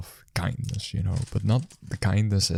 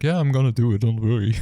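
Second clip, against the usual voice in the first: louder, flustered and half-laughing; stressed, laughing reassurance; 4 semitones higher, 5 dB louder than usual. Retold or imitated. Busy counter worker reassures customer, louder and flustered with a laugh. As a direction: brush it off while clearly frazzled. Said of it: "I'm just a bit stressed".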